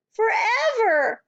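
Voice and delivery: very high, slow shouted cry; cried out. A narrator's reading. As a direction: wail it out. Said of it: "Matilda cried".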